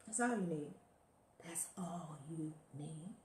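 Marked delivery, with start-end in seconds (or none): 1.4-3.1 slow, hushed whisper, much softer; breathy slow whisper of the same line. Shown as a demonstration. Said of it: "repeated line, then whispered".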